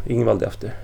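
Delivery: ordinary delivery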